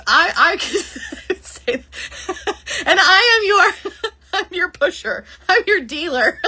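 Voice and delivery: high, breaking into laughter mid-sentence; real giggles, words in broken bursts; cracking up. Real, not acted. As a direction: struggle to get the joke out through laughter. Cracking up at drug-bead joke.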